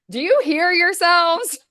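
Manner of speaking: high, loud, disbelieving retort; mock incredulous. Niece teasing relatives who say she has the accent, mock incredulous.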